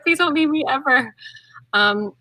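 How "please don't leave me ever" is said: pleading. Retold or imitated. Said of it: "I was like".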